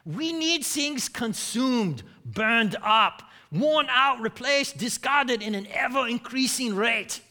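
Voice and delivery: emphatic fake German accent. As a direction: read it out as a mock German economist. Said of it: "in a fake German accent".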